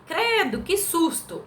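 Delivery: high, startled cry of fright; startled exclamation. Shown as a demonstration. Teacher reading an example interjection, startled and high. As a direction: gasp it out like a sudden scare. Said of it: "Pra dar aquela entonação".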